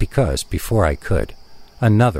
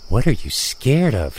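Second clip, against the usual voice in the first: plain reading voice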